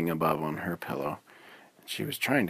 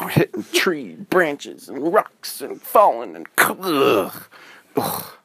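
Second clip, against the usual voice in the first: tumbling grunts; 6 semitones higher, 6 dB louder, slower than usual. A narrator's reading. Tumbling down hill.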